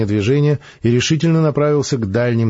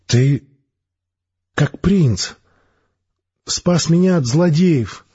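put-on higher, slow, weakly smiling girl; a young girl just coming round, higher and slow; dazed girl smiling up at her rescuer, higher; 7 semitones higher, much slower than usual. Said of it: "Она слабо улыбнулась".

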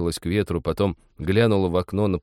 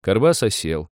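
ordinary delivery

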